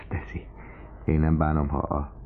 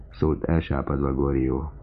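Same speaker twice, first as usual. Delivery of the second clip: usual speaking voice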